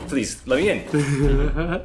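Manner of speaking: mock pleading. Begging door in game.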